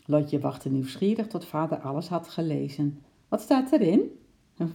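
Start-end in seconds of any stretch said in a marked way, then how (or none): 3.3-4.0 eager question. A narrator's reading. Curious girl presses father.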